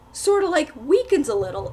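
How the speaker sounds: usual speaking voice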